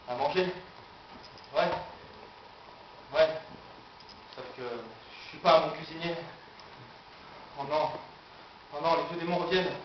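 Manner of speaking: quiet, low, hesitant muttering; low, halting self-doubt; sinking doubt. Acted. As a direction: murmur it to yourself as confidence drains. Doubts creep into his inner monologue.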